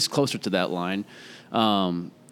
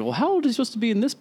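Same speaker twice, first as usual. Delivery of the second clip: incredulous question; 13 semitones higher, faster than usual. Retold or imitated. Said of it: "and I'm like".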